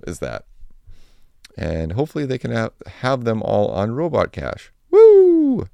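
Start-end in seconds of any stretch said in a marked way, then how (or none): 4.9-5.7 high, loud cheering whoop; excited whoop. Real, not acted. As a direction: let out a big celebratory woo. Cheering a podcast sponsor.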